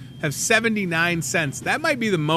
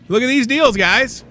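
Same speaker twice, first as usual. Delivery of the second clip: excited; 9 semitones higher, 7 dB louder than usual. Real, not acted. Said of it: "hyping the deals".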